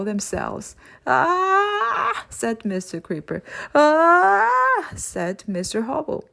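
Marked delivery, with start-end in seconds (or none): 1.1-2.2 a sudden high cry of alarm; slipping villain's high, startled yelp. Acted. Villain slipping on buttery floor. 3.7-4.9 high, loud cry of alarm; sliding across the floor with a high, loud yell; loud startled yell. Acted. Second villain slipping on floor.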